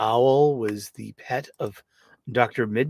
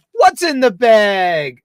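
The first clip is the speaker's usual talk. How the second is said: slow, louder teasing tease; teasing reveal; 7 semitones higher, 10 dB louder, slower than usual. Pretend. Host teasing viewers about the bag, slow excited tease. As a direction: build suspense.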